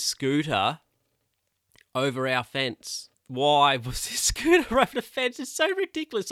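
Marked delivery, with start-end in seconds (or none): 3.3-6.3 laughing indignation. Real, not acted. Indignant at scooter over fence.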